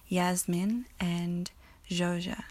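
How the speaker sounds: ordinary talk, nothing marked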